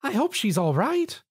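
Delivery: high, concerned murmur; worried motherly baker. Acted. Missus Cake worries after Pinkie, high. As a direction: fret after her.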